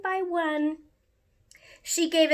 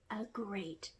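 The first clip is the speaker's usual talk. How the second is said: soft unvoiced whisper; whispered ending; 4 semitones lower, 18 dB quieter, faster than usual. A narrator's reading. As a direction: let it fade into a hush. Closing line fades to whisper.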